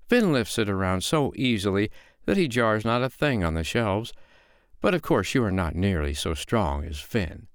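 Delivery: prim storybook woman finding fault; belittling foster mother. Acted. Foster mother belittles giant's feat.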